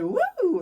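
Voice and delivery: high-pitched gleeful "woo"; imitated high whoop; retold whoop. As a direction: whoop like riders going downhill. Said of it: "we were just like".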